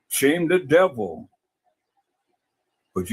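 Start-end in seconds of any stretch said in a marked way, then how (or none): 0.1-1.3 "Shame the devil" stretched out slowly; slow emphatic repeat. Real, not acted. Man repeats the saying to his critics, slowly and emphatically. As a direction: draw out each word for weight.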